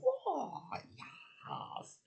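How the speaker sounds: breathy whispered whoa then yes; hushed amazed whisper; whispered delight. Retold or imitated. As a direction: whisper it in awe. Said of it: "I was like".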